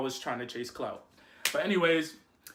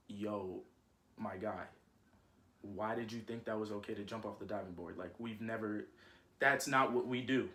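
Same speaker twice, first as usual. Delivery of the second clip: stern mom voice; 7 semitones lower, 9 dB quieter than usual. Retold or imitated. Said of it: "so she's like".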